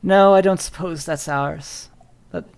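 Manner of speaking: lightly voiced old woman, halting; guarded thanks with long pauses; hesitant old lady. Old woman receives a lost duck from a student, lightly voiced and hesitant. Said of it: "kind of hard to read her expression".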